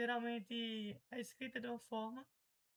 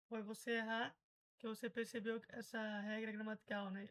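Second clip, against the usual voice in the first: ordinary delivery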